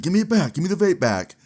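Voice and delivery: high-pitched mock pleading demand; frantic demand. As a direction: imitate an upset student wanting it back. Acted student demanding vape back.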